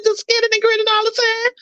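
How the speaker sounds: very high, put-on grinning voice; squeaky-high fake happy act; mock cheerfulness. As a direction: parody someone who grins nonstop. Mocking constant fake cheerfulness.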